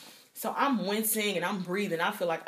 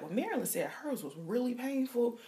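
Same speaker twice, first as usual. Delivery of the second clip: imitating a nervous patient; 4 semitones higher, 4 dB quieter than usual. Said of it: "you go in like".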